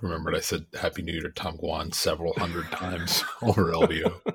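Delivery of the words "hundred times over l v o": laughing through the words; breaking into laughter mid-sentence. Real, not acted. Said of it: "I'm laughing".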